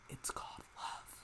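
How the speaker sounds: conspiratorial stage whisper; hushed, knowing aside; teasing whisper. Pretend. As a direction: whisper it like a secret to the listener. Teasing the oblivious heroine.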